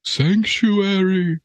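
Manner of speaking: high, slow drawn-out cry; dramatic plea. Pretend. Pleading for sanctuary as a defector. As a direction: beg for refuge theatrically.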